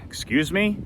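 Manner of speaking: high, rising question of disbelief; startled, questioning, without extra volume; shocked. Retold or imitated. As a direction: re-enact being taken aback. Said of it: "I was shocked".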